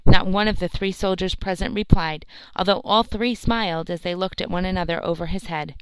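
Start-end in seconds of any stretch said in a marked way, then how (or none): none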